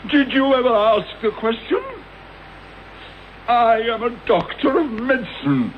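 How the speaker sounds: wounded pride. Acted. Bitter outburst listing his credentials.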